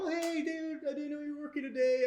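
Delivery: high-pitched, quick coworker greeting; friendly coworkers. Retold or imitated. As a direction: imitate pleasantly surprised coworkers. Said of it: "And they're like".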